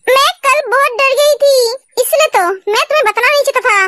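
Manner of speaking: ordinary delivery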